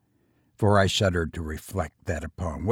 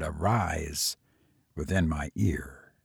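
softer, lower hushed word; understated whisper; 6 semitones lower, 7 dB quieter than usual. A narrator's reading. Unseen voice whispers into the dreamer's ear, understated whisper. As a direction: murmur it low, lightly suggesting a whisper. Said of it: "an impatient, gibbering voice whispered".

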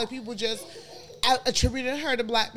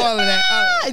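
laughing shout; 7 dB louder, faster than usual. Real, not acted. Hyping each other up, laughing.

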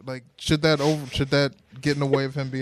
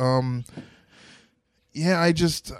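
ordinary talk

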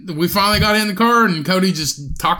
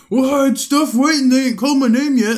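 mocking Cody; 8 semitones higher, 4 dB louder than usual. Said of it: "and he's like".

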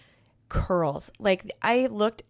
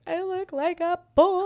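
1 mock little girl voice; 11 semitones higher, 4 dB louder, slower than usual. Mocking her younger self.

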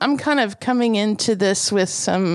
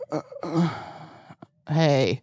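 softer, halting mock-sleepy hey; groggy hey; 6 semitones lower, 5 dB quieter than usual. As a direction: stumble into a drowsy hello. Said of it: "It'll just be like".

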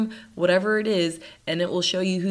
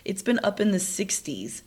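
ordinary talk

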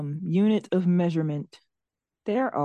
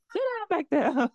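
high, stern bus driver command; 9 semitones higher than usual. Retold or imitated. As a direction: order the riders to sit. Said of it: "Sound like a bus driver".

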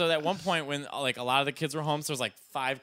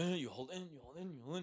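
hushed, bottled-up murmur; held-in grief; 2 semitones higher, 11 dB quieter than usual. Shown as a demonstration. Showing how Irish people grieve at wakes, held in. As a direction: demonstrate keeping it all inside, very softly. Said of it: "it's held in".

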